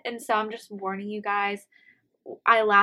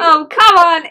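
playful protest; 8 semitones higher, 16 dB louder, slower than usual. Real, not acted.

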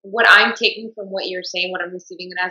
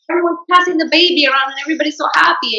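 much higher, louder mock gushing; gushing relatives; 11 semitones higher, 6 dB louder than usual. Mocking relatives gushing over the new baby, high mock cheer. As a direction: parody the gushing relatives, sing-song and over the top.

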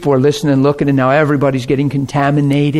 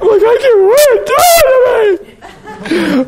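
very high, slow mock bawling; hammed-up helpless crying, louder at the start; pitiful wail; 4 dB louder, slower than usual. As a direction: squeal it through exaggerated sobs. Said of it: "I just remember being pitiful".

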